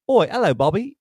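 mock British greeting, high and loud; British accent. Dancers in granddad's uniform greeting each other, British accent. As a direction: call out in an exaggerated British accent. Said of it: "all talk in British accents".

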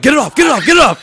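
re-enacted yell, urgent and high; retold yelling. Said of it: "like, I'm yelling".

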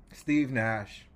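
ordinary delivery

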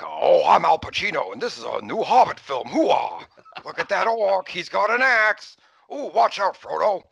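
loud, high hammed-up celebrity impression; shouty over-the-top movie star; Al Pacino impression. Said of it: "celebrity impressions ... like Al Pacino".

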